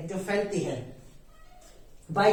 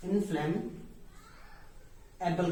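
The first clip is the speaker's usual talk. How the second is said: इनफ्लैमेबल split slowly, softer; slow syllable demonstration; 5 dB quieter, much slower than usual. Shown as a demonstration. Teacher breaks the term up for learners, slow and soft. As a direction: say in-flam... able with a long gap for इनफ्लैमेबल. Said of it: "इसको बोलते हैं".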